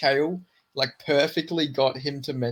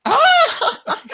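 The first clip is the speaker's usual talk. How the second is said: very high, loud mock shriek; hysterical laugh imitation; 10 dB louder than usual. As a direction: burst out in a piercing imitated cackle. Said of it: "He just starts laughing hysterically".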